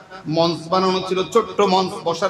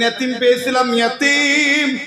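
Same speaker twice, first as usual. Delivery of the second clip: rising, high preacher's chant; impassioned preaching; 7 semitones higher, 3 dB louder than usual. Acted. Preacher voicing God reminding the orphaned Prophet, impassioned rising chant.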